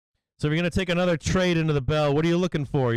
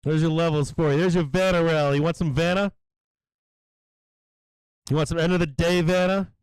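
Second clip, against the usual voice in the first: gleeful yelling; 3 semitones higher than usual. Real, not acted. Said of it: "I'll stop yelling".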